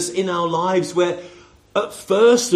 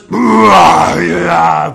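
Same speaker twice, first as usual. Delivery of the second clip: rock roar; 4 semitones lower, 10 dB louder than usual. Retold or imitated. Mimicking a huge rock voice.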